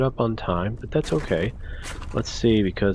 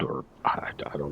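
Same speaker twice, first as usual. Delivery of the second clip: whispering; 5 semitones lower, 8 dB quieter than usual. Real, not acted. Muttering unsure to self.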